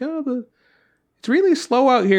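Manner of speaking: high-pitched imitation of a grumbling mom; complaining mom. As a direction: whine it like a mother stuck waiting. Voices mom complaining about slow computer.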